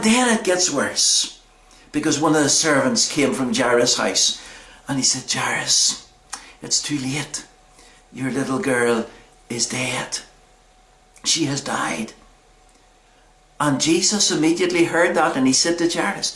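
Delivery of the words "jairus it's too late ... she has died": low, soft, halting delivery; grave bad news. Servant telling Jairus his daughter has died, hushed and grave. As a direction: break it gently, with long pauses.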